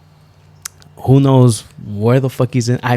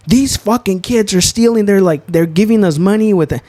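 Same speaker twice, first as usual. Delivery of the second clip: high, indignant complaint; hammed-up outrage at the kids; mock bystander; 8 semitones higher than usual. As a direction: crowd complaining about kids paying with fake money.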